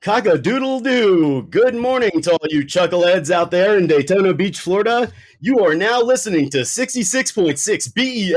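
hammed-up morning radio DJ; shock jock. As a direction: boom it out like a zany radio host. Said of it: "like, shock jock style".

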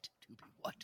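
breathy teasing whisper. Real, not acted. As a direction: whisper it slyly.

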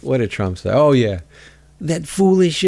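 ordinary delivery

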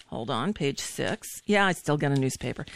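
quiet aside. Real, not acted. Aside while turning page.

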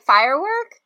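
ordinary delivery

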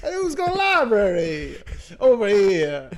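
high, loud hammed-up wiseguy; mock Italian-American guy. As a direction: call it out like a cartoon mobster. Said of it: "Italian bit continues".

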